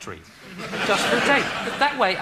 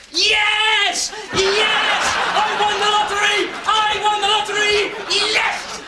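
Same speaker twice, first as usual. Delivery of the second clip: high, loud jubilant shouts; ecstatic shouting; 13 semitones higher, 5 dB louder, slower than usual. Acted. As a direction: scream with joy at winning. Celebrates winning the lottery.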